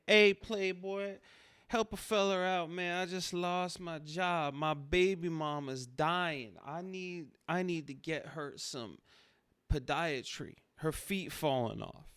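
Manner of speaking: hammed-up street hustler pitch. Shown as a demonstration. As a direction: sell it like a desperate street hustler. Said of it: "you say".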